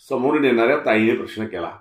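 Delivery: ordinary delivery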